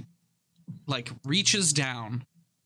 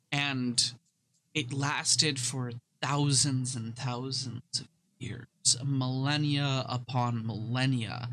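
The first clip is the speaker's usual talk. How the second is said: storytelling. Acted. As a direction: tell it like an ancient legend, unhurried. NPC tells ancient history.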